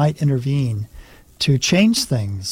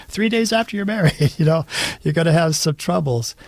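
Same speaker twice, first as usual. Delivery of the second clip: higher-pitched, chuckling through the words; amused chuckle; 5 semitones higher than usual. Real, not acted. Priest recalls Mother's warning to newlyweds, chuckling. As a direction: say it through a light laugh.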